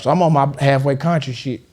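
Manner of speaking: ordinary talk, nothing marked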